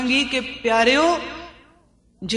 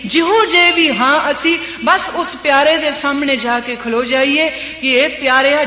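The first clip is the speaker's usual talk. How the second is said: fervent repeat; 3 semitones higher, 4 dB louder than usual. Acted.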